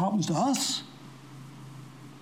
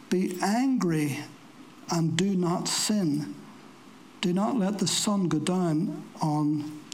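slow scripture reading; 3 semitones lower, 4 dB quieter, slower than usual. Acted. Scripture read slowly.

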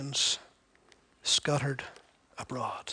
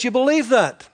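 higher, louder mock congratulation; sarcastic; 6 semitones higher, 7 dB louder than usual. Preacher voicing James mocking bare belief, sarcastic. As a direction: fake applause that stings. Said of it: "So he says".

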